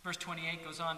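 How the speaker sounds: ordinary delivery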